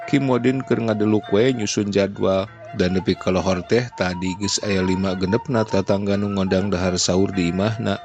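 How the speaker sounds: ordinary delivery, nothing marked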